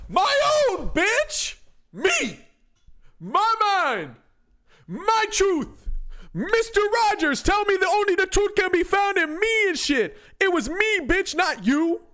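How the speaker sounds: high, loud gloating shout; triumphant yelling. Real, not acted. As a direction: scream it in victory. Triumphant yell after right answer.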